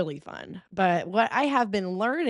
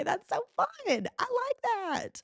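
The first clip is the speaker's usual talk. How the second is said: delighted; much slower than usual. Real, not acted. Delighted self-praise.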